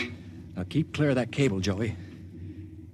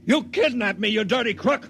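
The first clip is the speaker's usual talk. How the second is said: higher and louder, hurling the accusation; angry outburst; 8 semitones higher, 5 dB louder, slower than usual. Acted. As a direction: shout it at the man who tricked you. Accused prisoner lashes out.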